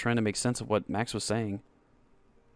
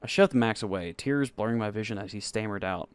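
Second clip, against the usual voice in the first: ordinary delivery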